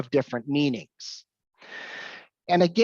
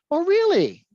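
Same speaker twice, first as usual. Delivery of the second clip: a high, playful fake "Oh, really?"; mock surprise; 12 semitones higher, 4 dB louder than usual. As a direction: put on surprise to play along. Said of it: "doing this intentionally".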